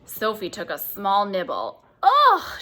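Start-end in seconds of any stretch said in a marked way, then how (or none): 2.0-2.6 very high, loud disgusted ugh; sputtered ugh. A narrator's reading. Sophie tastes the snozcumber and sputters in disgust. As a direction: spit the taste out in one cry. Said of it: "she sputtered".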